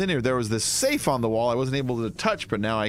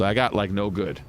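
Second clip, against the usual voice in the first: lower, smug villain brag; mock evil boast; 5 semitones lower than usual. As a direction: brag like a cartoon baddie, lower.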